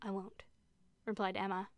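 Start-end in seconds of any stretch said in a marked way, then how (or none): 0.0-0.4 much softer, secretive answer; hushed reply. A narrator's reading. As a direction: agree under the breath. Answering sister's whisper in kind.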